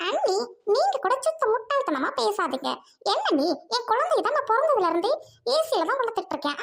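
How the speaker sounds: haughty sister scolding at her gate; snobbish rich sister. Acted. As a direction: talk down to the noisy crowd at the gate. Sister sends brother away.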